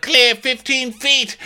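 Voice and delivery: very high, slow deck holler; calling out. Acted. Ross calling distance to the captain, deck holler. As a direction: sing out the distance high and long.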